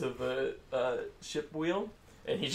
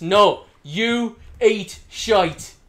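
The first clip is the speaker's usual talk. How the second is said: slow, loud, higher snarl; angry prisoner; 7 semitones higher, 10 dB louder, much slower than usual. Acted. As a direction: spit it back slowly at him. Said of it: "He goes".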